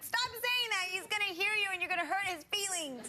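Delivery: high, pleading protest; pleading fan. Retold or imitated. Guest imitating herself scolding hecklers, high and pleading. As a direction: beg the hecklers to stop, half laughing. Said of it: "And I'm like".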